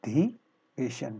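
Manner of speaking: अधिवेशन very slowly, "a... dhi... veshan"; syllable by syllable. Shown as a demonstration. Teacher spelling it out for students, slow pieces. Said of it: "अधिवेशन होता है".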